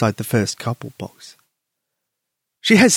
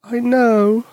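tearful; 10 semitones higher than usual. Acted. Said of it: "a tear ran down her cheek".

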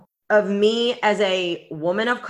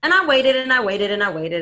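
ordinary delivery

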